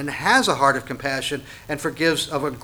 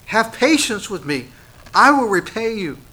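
higher, louder begging fellow slave; mild pleading plea; 7 semitones higher, 5 dB louder than usual. Acted. Fellow slave begs the one he owes, higher and louder. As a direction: beg the same words, pushing up in pitch. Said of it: "having almost the same words".

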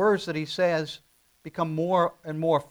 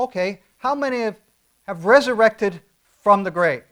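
higher and louder, pressing hard; full-force preaching; 6 semitones higher, 9 dB louder than usual. Acted. Pastor presses the congregation, preaching at full force. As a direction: preach it, lean into every word.

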